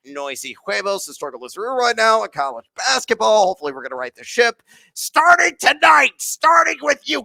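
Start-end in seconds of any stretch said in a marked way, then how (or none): 4.9-7.2 high, loud fired-up call; rallying shout. Real, not acted. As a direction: pump the team up. Rallying his team to win.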